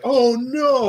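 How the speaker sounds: high, loud mock "oh no"; put-on cry of dismay; imitated dismay. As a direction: mimic a player's alarm at the machine. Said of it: "she's like".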